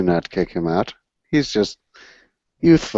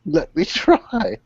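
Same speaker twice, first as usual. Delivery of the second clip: put-on coy young woman; 8 semitones higher, slower than usual. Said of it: "And she said".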